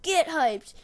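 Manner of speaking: high, loud rallying yell; retold screaming hype cry. Cheering every good play by the team, high yell. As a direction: re-enact yelling to pump up teammates. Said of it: "started screaming as loud as I could".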